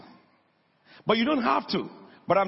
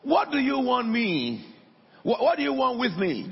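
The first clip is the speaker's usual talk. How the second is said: raised, higher-pitched quoted cry; mock shout; 7 semitones higher than usual. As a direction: act out a demand without full volume. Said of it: "He shouted at the top of his voice".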